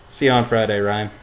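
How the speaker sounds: ordinary delivery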